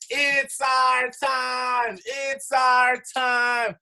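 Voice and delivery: slow, drawn-out rallying shout; hype shout. Real, not acted. Performer rallies the call audience, slow drawn-out shout. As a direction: stretch every word and call it out loud.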